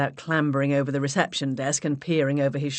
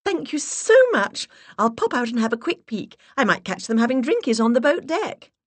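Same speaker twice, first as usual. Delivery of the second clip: much higher and louder, gushing with delight; delighted put-on voice; 8 semitones higher, 6 dB louder than usual. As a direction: gush like a thrilled socialite. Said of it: "I clapped my hands in delight".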